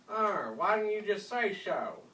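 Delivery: put-on high, loud, slow thick country accent; thick rustic accent. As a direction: drawl it like a rough country girl. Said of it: "in a thick accent".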